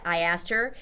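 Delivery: ordinary delivery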